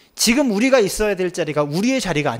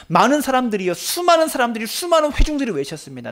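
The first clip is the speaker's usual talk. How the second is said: rising intensity; 7 semitones higher than usual. Real, not acted. Preacher describing the shouting crowd, building up. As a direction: climb with each phrase.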